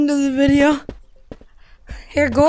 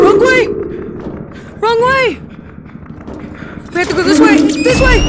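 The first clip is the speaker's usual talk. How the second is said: urgent shout; 7 semitones higher than usual. Real, not acted. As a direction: shout the way to a lost friend. Directing friend in panic.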